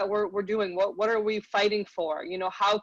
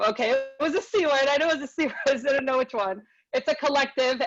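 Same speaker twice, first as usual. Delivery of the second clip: laughing; 6 semitones higher, 4 dB louder, faster than usual. Real, not acted. Laughing at forgotten word.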